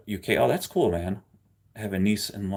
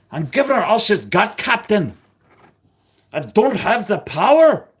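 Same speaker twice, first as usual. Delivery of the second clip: high, loud Scottish accent, hammed up; Scottish engineer impression; 11 semitones higher, 7 dB louder than usual. Streamer doing a Scottish starship engineer to chat, loud Scottish accent. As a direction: bellow it in Scottish like a ship's engineer to his captain. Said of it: "Like doing the Scottish one".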